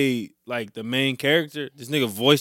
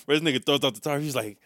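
ordinary delivery

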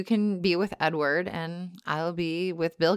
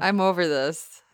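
ordinary talk, nothing marked

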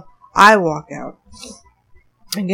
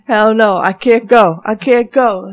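loud, firm hell no; loud refusal; 8 dB louder than usual. Retold or imitated. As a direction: refuse it loudly. Retold refusal.

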